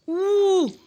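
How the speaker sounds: high, loud surprised "oh"; a sudden amazed exclamation. Real, not acted. Gasp at in-game event.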